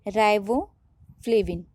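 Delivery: Riboflavin drawn out slowly syllable by syllable; slow and spelled out. Shown as a demonstration. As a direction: stretch riboflavin slowly so students catch it. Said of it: "ठीक है, क्या है". (Riboflavin को धीरे-धीरे खींचकर)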